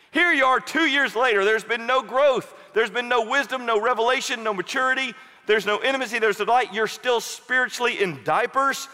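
rising, pressing sermon rebuke; fervent preaching. Acted. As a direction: preach it hard to the congregation. Preaches at full force.

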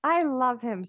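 high-pitched, delighted cooing; adoring gush. Real, not acted. Friend seeing the baby on a call, gushing adoringly.

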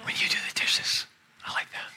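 hushed, voiceless stage whisper; imitated whisper. Husband imitating his wife leaning in to him, whispering. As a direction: whisper it as if close to the ear. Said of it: "when she's whispering to me".

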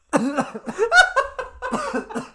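wheezing burst of laughter; loud high cackle. Real, not acted. Podcaster cracks up at the shotgun scene, loud cackle. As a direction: lose it completely.

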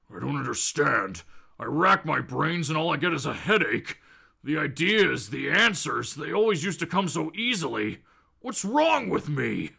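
low, brooding muttering to oneself; Hulk. Acted. Hulk puzzling over lost brainpower, low and brooding. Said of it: "he's saying to himself".

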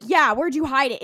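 loud, bickering comeback; exasperated retort. Retold or imitated. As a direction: fire back loudly in a mock argument. Acted bickering over hidden computer.

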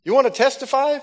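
mock the preacher friend, teasing him to testify. Friend teasing him on the floor, playful high call. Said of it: "leans over my feet and says".

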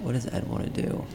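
breathy ASMR whisper. Pretend. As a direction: whisper it close to the mic. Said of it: "in A S M R voice".

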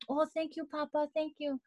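a high, soft little thank-you; sweet, soft, high thanks to Papa; high soft grateful thanks. Retold or imitated. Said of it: "she's like".

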